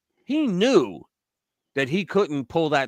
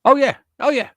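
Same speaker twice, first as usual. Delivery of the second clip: excited agreement; 11 semitones higher, 8 dB louder than usual. Real, not acted. Enthusiastic agreement.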